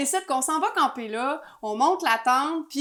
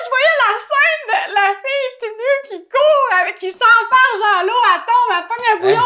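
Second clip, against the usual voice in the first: high, loud telling through laughter; laughing; 8 semitones higher, 8 dB louder than usual. Real, not acted. Storyteller recalls the chaotic scene, laughing. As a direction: crack up while describing it. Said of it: "laughing retelling".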